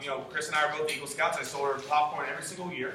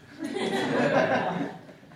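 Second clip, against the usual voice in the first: high laughing burst; 11 semitones higher than usual. Real, not acted. Speaker to the room laughing at himself. Laughing at his own story.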